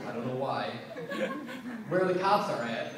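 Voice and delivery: ordinary delivery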